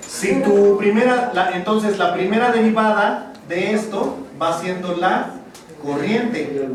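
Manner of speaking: ordinary talk, nothing marked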